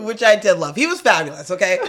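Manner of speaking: ordinary talk, nothing marked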